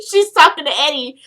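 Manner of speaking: laughing through the words, high and loud. Real, not acted. Recapping the show to viewers, laughing. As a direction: crack up while carrying on talking. Cracking up at her own recap.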